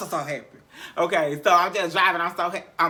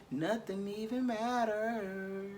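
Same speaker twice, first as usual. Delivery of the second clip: slow, softer, drifting words; 3 semitones higher, 12 dB quieter, much slower than usual. Retold or imitated. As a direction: let each word float out blissfully. Retold saying it while high.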